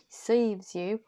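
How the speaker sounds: "Serves" in a strange accent, the vowel stretched toward "seeves" with a lift at the end; odd accent. Shown as a demonstration. Reader re-tries the phrase to name the accent, odd accent. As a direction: repeat "Serves you" in that mystery accent, not American. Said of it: "What kind of accent is that?".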